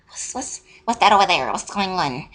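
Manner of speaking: mock nosy murmuring, stuttered start; nosy onlookers. Lunchroom kids peering over at the standoff, mock nosy. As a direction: imitate gawkers, whisper rising into a high voice.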